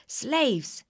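sharp loud shout of slaves. A narrator's reading. Wicked witch shouting for her slaves. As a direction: bark it out, high and loud. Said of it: "she shouted".

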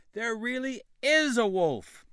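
high, loud, frightened insisting; desperate plea. A narrator's reading. Boy begging his father, frightened and loud. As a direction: beg him to believe you.

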